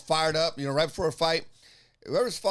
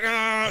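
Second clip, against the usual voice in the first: loud, higher mock growl; frustrated growl; 5 semitones higher, 5 dB louder than usual. Fighter fed up with heckler, loud growl. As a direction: let out a pent-up fighter's growl. Imitates his fired-up growl.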